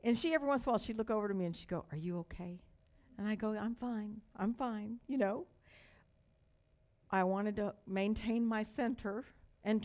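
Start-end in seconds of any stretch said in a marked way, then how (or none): none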